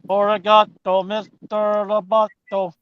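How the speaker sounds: high, slow robot voice. Pretend. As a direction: chant it like a robot. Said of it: "You sound robotic-y".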